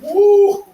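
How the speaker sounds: loud high whoop of laughter; laughing whoop. Acted. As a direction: let out a big laughing woo.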